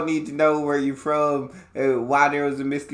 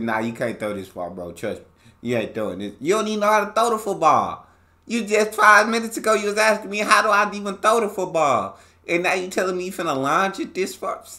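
retold scolding; 6 semitones higher than usual. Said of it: "I would have said".

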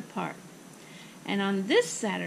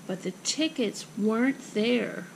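plain reading voice

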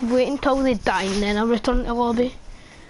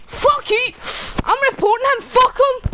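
furious raging shout; angry; 11 semitones higher, 3 dB louder than usual. Real, not acted. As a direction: rage at the hacker. Killed, raging at hacker.

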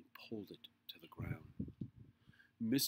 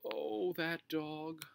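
slow, high, louder sigh of dismay; exasperated mother; 9 semitones higher, 6 dB louder, much slower than usual. A narrator's reading. Henry's mother seeing Ribsy run by, exasperated. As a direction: draw it out in exasperation. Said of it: "Henry heard his mother say".

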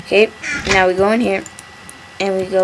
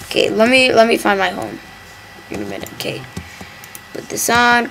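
ordinary delivery

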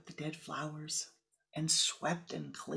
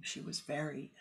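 usual speaking voice

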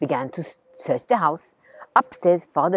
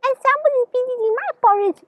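high, fast, louder mother-bear voice; 7 dB louder, faster than usual. Acted. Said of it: "said Mother Bear".

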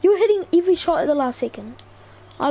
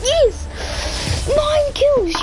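shrieking jackpot winner; 10 semitones higher, much slower than usual. Real, not acted. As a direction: scream it like you won big. Celebrating nine kills.

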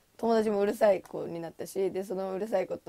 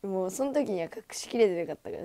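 usual speaking voice

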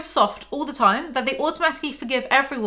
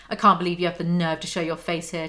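ordinary talk

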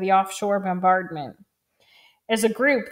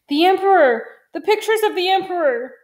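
high, loud cry of alarm; frantic; 9 semitones higher, 6 dB louder than usual. A narrator's reading. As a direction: exclaim as the distraught principal. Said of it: "had never seen Principal Kojima so frantic".

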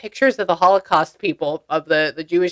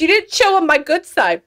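high, loud and laughing; amused outburst; 9 semitones higher, 5 dB louder than usual. Real, not acted. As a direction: burst out relaying the joke, pitch way up. Amused, relaying spirit's joke about picture.

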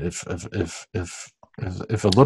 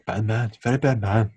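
Babu Bhatt voice; 8 dB quieter than usual. Retold or imitated. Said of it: "Babu Bot from Seinfeld".

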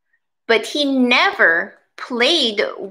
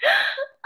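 high shocked gasp; 3 dB quieter than usual. Acted.

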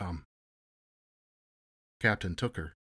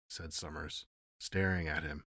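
plain reading voice

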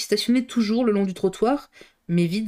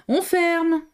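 high guard's call, shouted out; cri de fermeture; 10 semitones higher than usual. A narrator's reading. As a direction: call out closing time across the hall. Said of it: "retentit le cri quotidien et réglementaire". (appel crié du gardien)

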